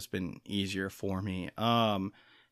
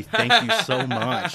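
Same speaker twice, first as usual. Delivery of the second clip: laughing thanks; 5 semitones higher, 10 dB louder, slower than usual. Real, not acted. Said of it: "no sarcasm at all".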